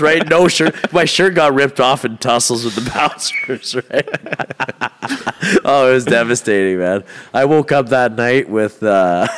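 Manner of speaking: usual speaking voice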